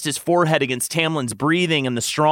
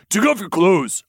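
commanding; 7 semitones higher, 3 dB louder than usual. Acted. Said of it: "Lucien ordered".

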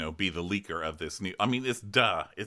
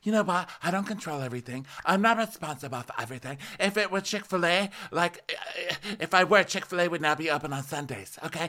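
imitating Lisa; 8 semitones higher than usual. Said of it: "And she's like".

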